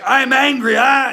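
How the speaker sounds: half-acted furious cry of "I'm angry"; mock angry outburst. Shown as a demonstration. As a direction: blow up at the boss, hammed-up. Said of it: "you're just gonna let loose".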